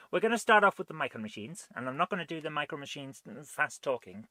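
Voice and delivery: ordinary talk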